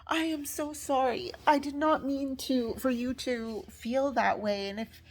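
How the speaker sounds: imitated effusive apology. Instructor apologizes to upset student, effusively. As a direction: mimic an instructor apologizing profusely. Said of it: "she started being super nice".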